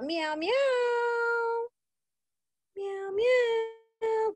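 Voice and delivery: put-on high playful cat meows for the kids; kitty meow. Teacher voices kitty cat for kids.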